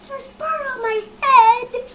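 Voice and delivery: high, loud alarmed wail; panicked cry. Acted. Elephant panicking to Piggy about the bird on his head.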